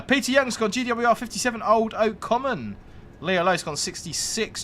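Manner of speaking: ordinary talk, nothing marked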